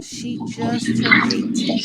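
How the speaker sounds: high, slow, breathy madam voice; sleazy madam voice. Acted. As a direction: purr it like a greedy madam pricing her goods. Madam selling the granddaughter.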